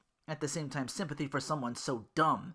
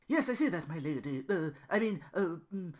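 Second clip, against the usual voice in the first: stuttering; 6 semitones higher than usual. Acted. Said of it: "he began to stutter".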